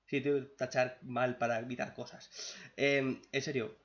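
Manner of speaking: ordinary delivery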